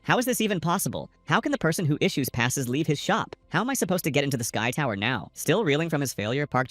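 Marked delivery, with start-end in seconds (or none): none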